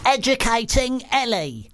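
high, drawn-out jingle-like title read; stretched, lifted mock-announcer delivery; feature title announcement. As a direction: announce it like a grand show title, slow and high.

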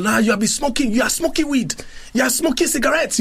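high-pitched mock outrage; accusing dad. Dad accusing son of smoking, hammed-up outrage. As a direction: pile up the accusations, voice jumping high.

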